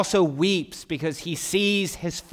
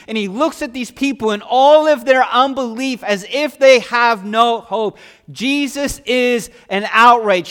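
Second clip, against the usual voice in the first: louder, driving preacher's climb; rising pulpit fervor; preaching at full force; 2 semitones higher, 6 dB louder than usual. Acted. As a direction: build the sermon to a loud peak. Preacher builds to outrage.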